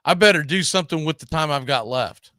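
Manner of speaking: ordinary talk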